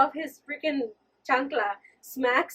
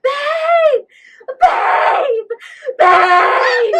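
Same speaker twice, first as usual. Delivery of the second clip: high, much louder desperate shouts; yelling for husband; 11 semitones higher, 15 dB louder, much slower than usual. Retold or imitated. Trapped in car, yelling for husband upstairs, loud desperate shouts. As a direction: scream his name as if he can't hear. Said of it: "and I was yelling".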